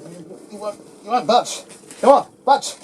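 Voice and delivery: straining, pleading with the stuck box; frustrated coaxing. Real, not acted. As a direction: urge it out with a loud strain. Straining to lift heavy console.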